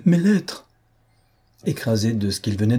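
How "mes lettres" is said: high-pitched, urgent exclamation; exclaimed cry. A narrator's reading. Marquise demanding her letters once more, urgent cry. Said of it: "s'écria madame de Langeais".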